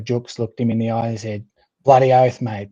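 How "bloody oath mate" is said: put-on a broad Australian accent on the mate, said louder; mock a true-blue Australian bloke; Aussie accent. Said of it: "but not in that accent".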